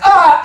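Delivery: loud, high cry of shock; sudden high shriek; startled yell. Acted. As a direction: yelp at a nasty discovery. Zeus finds the slime.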